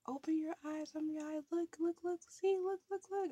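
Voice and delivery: mother urging daughter to look, high coaxing. Retold or imitated. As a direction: coax her like a nervous mom way up high. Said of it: "like that".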